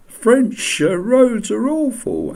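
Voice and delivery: put-on high, sneering kid voice, drawn out; high mock jeer of French roads; mocking snooty kids. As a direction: mimic a bratty English schoolkid. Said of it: "they used to say things like".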